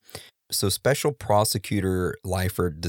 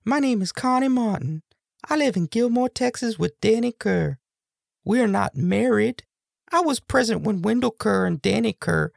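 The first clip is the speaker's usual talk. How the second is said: put-on high falsetto girly voice; girl voice. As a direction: squeak it out high like a woman. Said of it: "was gonna put on a girl voice".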